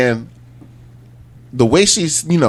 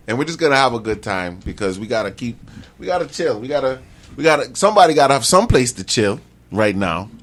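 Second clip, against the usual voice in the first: usual speaking voice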